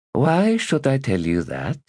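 lightly voiced teasing lady; coy refusal.

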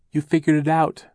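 lightly voiced relieved aunt; a hint of the relieved older aunt. A narrator's reading.

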